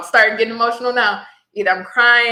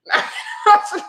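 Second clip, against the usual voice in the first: emotional laugh-cry; 13 semitones higher, 4 dB louder than usual. Real, not acted. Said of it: "now I'm getting emotional".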